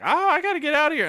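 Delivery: high, fast, put-on voice of the older lady; fed-up older lady. Narrator voicing the older coworker bailing on her shift, high and hurried. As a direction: rattle it off high, desperate to leave.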